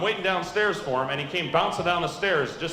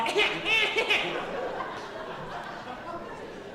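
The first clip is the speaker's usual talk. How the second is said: much higher shrill cackling laugh; mom's laugh imitated; 13 semitones higher, 6 dB quieter than usual. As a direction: cackle high like the mother. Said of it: "Mom's going".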